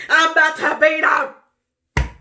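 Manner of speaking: high, loud shouted threat. Retold or imitated. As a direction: yell it out the second time. Repeated louder.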